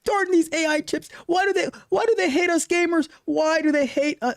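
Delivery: high, whiny imitation of an upset gamer; hammed-up pouty complaint; mock pouting. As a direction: put on a high-pitched woe-is-me whine. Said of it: "the thing you were pouting about".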